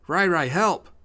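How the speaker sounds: high, loud plea to a brother; cry for help. Acted. Wounded Tommy sees Ryan, crying for help. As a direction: shout high for rescue.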